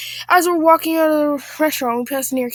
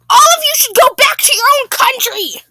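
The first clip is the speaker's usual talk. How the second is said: very high, loud Karen screech; hammed-up shriek of outrage; furious scream; 13 semitones higher, 7 dB louder than usual. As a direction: yell it at the whole table. Acted Karen screaming at diners.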